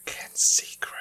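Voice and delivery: whispered prompt; whispering. Real, not acted. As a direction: breathe it out unvoiced.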